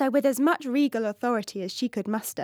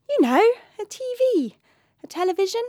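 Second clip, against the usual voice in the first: put-on high, bright-eyed young princess; a young princess explaining eagerly; 9 semitones higher, 5 dB louder, faster than usual.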